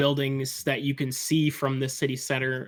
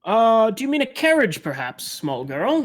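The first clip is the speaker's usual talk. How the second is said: louder, higher dwarf barkeep, then slowing; gruff dwarf bartender; 6 semitones higher, 3 dB louder than usual. Acted. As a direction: query it as the puzzled dwarf bartender. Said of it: "a gruff-looking dwarf, and he goes".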